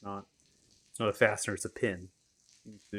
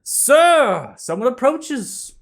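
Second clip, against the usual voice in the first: much higher, louder flamboyant bard voice; high theatrical bard; 16 dB louder, much slower than usual. Acted. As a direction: play a showy bard, high and loud.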